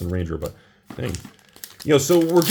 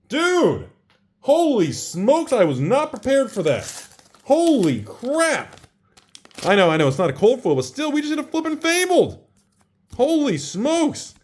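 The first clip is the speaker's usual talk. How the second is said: excited disbelief; 11 semitones higher than usual. Real, not acted. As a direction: stretch every word in awe. Still reeling from the pull.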